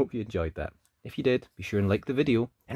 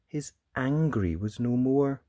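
plain reading voice

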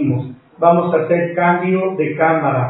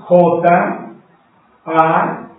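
ordinary talk, nothing marked

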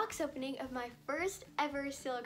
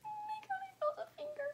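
excited squeal. Real, not acted. Shrieks on feeling a finger.

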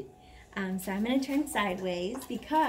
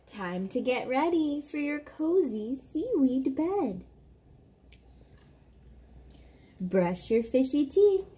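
bedtime story reading; 4 semitones higher, 3 dB louder than usual. Acted. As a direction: read it like lulling a child to sleep. Reads bedtime book aloud.

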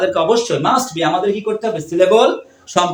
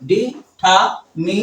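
"ডি, ঠা, মি" as separate beats; teacher dictating syllables. Shown as a demonstration. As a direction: leave a clear pause after each of "ডি, ঠা, মি". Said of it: "আমার সাথে বলো".

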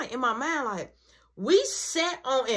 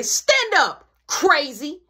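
mom's order; 5 semitones higher, 5 dB louder, much slower than usual. Retold or imitated. Mom barking order.

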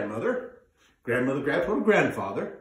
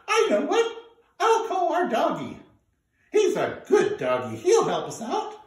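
put-on much higher little girl voice, fretting; young granddaughter; 4 dB louder than usual. Granddaughter worrying about the stuck turnip. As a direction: fret in a high young voice.

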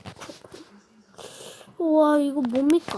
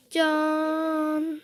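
very slow sing-song ta-da; drawn-out ta-da; much slower than usual. Pretend. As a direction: stretch the ta-da way out.

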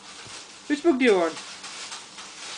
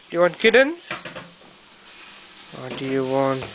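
ordinary talk, nothing marked

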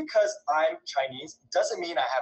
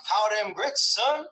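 a hammed-up Southern drawl, pitched higher; mock Southern accent; 8 semitones higher, slower than usual. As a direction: play a joke Southern twang at a Kentuckian. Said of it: "that's my Southern accent".

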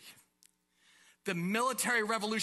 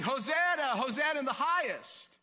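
higher, ringing pilgrim cry; shouting; 6 semitones higher, 3 dB louder than usual. Acted. Preacher voices pilgrims waving palms on Palm Sunday, shouting. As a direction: call it out in celebration. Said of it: "and they're shouting".

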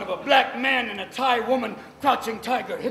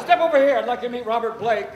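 mock taunt; 4 semitones higher, 8 dB louder than usual. Soldier mocking the captive.

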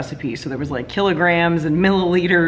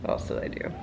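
whisper; 12 dB quieter, faster than usual. Real, not acted. Whispered aside.

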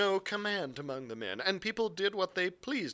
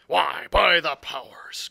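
booming pirate cook, drawn out; loud cry; 11 dB louder, slower than usual. Acted. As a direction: bellow it like an old sea cook. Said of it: "cried Long John".

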